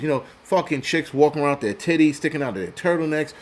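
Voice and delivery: normal talking voice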